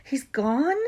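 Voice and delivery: urgent, rising disbelief; frantic. A narrator's reading. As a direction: blurt it out in alarm. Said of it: "said Harry frantically".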